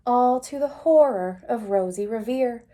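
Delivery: ordinary delivery, nothing marked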